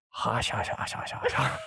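rapid string of voiceless "hush" noises; repeated hissy shushing imitation; imitated hushing noise. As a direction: imitate mother's mock noise, mostly breath.

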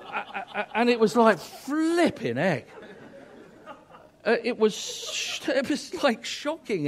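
half laughing, half aghast. Real, not acted. Preacher laughing while telling the fisherman story. Laughing recalling a shocking remark.

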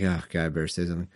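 quick, low muttered aside to himself; hurried, soft grumble; God caught off guard. Acted. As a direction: play a reluctant God talking to himself. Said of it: "and then he's like".

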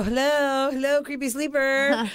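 slow, sing-song hello; playfully rousing a drowsy husband; teasing coo. Retold or imitated. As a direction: tease him awake. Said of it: "I go".

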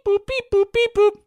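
high, sing-song beep boop noises; mock phone beeps. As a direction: chirp the beeps like a silly phone. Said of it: "They went beep".

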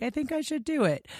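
quoting a singer in a high, breezy voice. Retold or imitated.

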